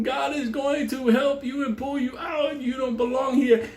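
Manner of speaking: high-pitched, insistent priest's call; exorcising priest. Retold or imitated. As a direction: call out as a priest casting out a demon. Priest chanting over possessed man.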